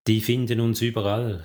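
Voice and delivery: plain reading voice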